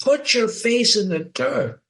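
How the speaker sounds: mock scream. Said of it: "and she screams".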